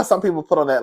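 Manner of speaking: ordinary talk, nothing marked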